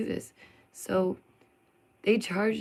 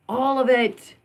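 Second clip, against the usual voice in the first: all of it, louder and higher with delight; gushing exclamation; 5 semitones higher, 8 dB louder, slower than usual. Real, not acted. Vlogger gushing over her favorite festival, excited. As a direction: burst out with joy.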